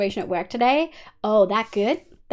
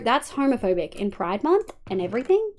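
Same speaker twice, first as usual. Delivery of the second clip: ordinary delivery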